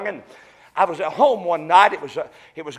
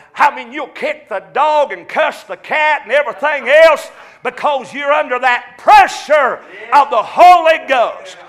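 preaching at full force; 6 semitones higher, 6 dB louder than usual. Acted. Preaching, exhorting the congregation.

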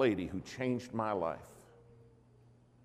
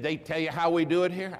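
higher and louder, knowing question; a hint of a sassy church lady quizzing him; 7 semitones higher, 5 dB louder than usual. Lady asks the preacher.

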